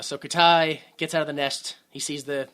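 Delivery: ordinary talk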